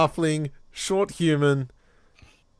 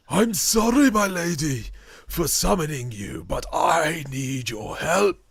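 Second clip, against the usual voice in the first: high, thin voice of a frail summoner; lightly voiced gaunt old man, begging; frail pleading old man; 6 semitones higher, 5 dB louder than usual.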